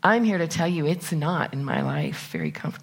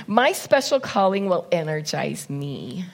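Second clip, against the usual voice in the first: normal talking voice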